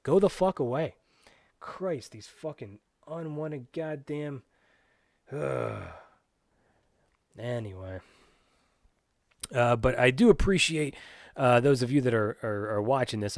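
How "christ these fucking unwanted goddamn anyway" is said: tired exasperated sigh. Real, not acted. Trailing exasperated mutter.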